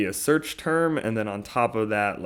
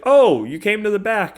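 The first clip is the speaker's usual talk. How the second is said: much higher, louder surprise; mock surprised manager; 11 semitones higher, 8 dB louder than usual. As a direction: quote her noticing you. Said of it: "She's like".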